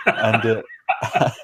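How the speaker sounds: ordinary talk, nothing marked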